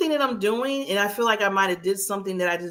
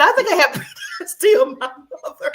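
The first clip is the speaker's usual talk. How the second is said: laughing quote; 10 semitones higher, 5 dB louder, much faster than usual. Retold or imitated. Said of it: "I said".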